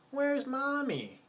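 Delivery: put-on very high, slow baby voice; imagined cute toddler. Baby's imagined first sentence, mock cute baby voice. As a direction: pretend to be a tiny child asking.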